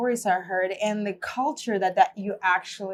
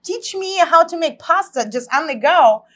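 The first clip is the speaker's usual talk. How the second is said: higher, louder, incredulous retold thought; amazed disbelief; 7 semitones higher, 7 dB louder than usual. Siberian woman marvelling at meeting an Italian pasta teacher, amazed disbelief. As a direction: exclaim it in happy amazement.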